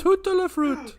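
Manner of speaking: high, laughing fake French.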